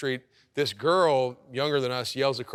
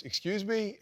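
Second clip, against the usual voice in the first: higher, softer imitation of a puzzled wife; questioning wife; 8 semitones higher, 5 dB quieter, slower than usual. Wife answers a girl yelling across the street, high puzzled query. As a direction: imitate her asking, pitched well up and quiet. Wife's retort to a yelling stranger.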